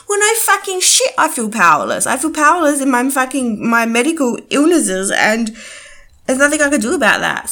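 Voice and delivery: loud, furious outburst; venting real frustration loudly; angry rant. Real, not acted. Angry rant about illness.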